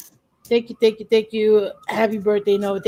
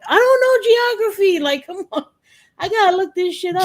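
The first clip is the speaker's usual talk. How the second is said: mock outrage; 11 semitones higher, 3 dB louder than usual. Mock self-scolding over geography.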